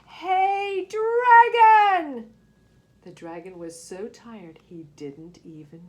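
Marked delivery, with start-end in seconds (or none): 0.1-2.3 high, very loud yell into the ear; full shout. A narrator's reading. Elizabeth yelling into the sleeping dragon's ear, full shout. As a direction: bellow it as loud as you can. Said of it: "she shouted as loud as she could".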